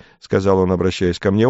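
ordinary delivery

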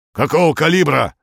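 sudden loud, high colonel's bark. A narrator's reading. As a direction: bark it out like an old army colonel. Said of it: "рявкнул полковник". (рявкнув по-полковничьи)

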